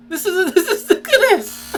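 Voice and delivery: laughing. Real, not acted. Laughing at frightening dog.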